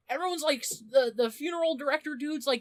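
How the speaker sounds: ordinary delivery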